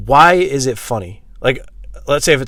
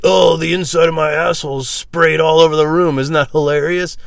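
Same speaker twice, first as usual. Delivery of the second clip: mocking gloat; 6 semitones higher, 5 dB louder than usual. Mocks someone proud of farting.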